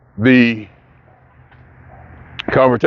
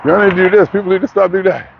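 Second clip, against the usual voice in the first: high, whiny mocking quote; high whining mock lecture from the left-leaning news; 5 semitones higher than usual. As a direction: mimic a preachy complaining network. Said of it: "not whining and say".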